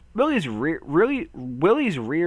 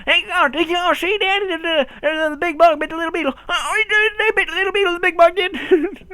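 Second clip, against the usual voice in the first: very high, loud squeaky character; high put-on voice; 7 dB louder than usual.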